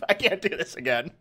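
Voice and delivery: ordinary talk, nothing marked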